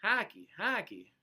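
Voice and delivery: put-on light Canadian accent. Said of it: "I said it with a Canadian accent".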